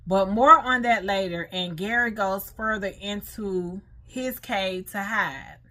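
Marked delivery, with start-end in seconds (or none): none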